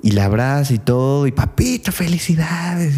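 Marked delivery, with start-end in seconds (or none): 1.3-3.0 sweet daughter. Acted. Daughter greets victorious father.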